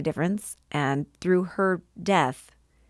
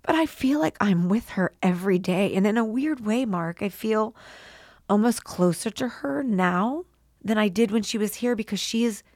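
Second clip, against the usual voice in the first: tearful; 3 semitones higher than usual. Real, not acted. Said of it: "I get emotional".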